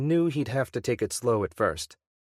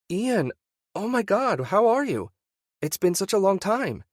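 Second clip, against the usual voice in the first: high, louder, gushing surprise; delighted wife; 11 semitones higher, 4 dB louder than usual. Acted. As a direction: she is thrilled to hear from him. Wife's delighted phone greeting.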